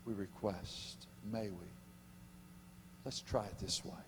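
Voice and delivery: soft, slow, humble asking; humble request. Pretend. Daniel's humble request to the king, soft and meek. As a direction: ask meekly and gently. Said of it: "King said".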